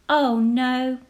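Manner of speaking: usual speaking voice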